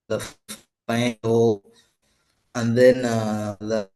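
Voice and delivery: breaking up on a bad call line; words dropping out mid phrase; choppy cut off endings. Real, not acted. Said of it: "you're cutting out".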